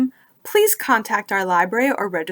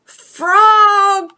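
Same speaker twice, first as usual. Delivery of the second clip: much higher, loud call across the pond; 11 semitones higher, 9 dB louder than usual. Acted. Toad calls for Frog, loud high call. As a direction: call out for a lost friend. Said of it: "cried the thing".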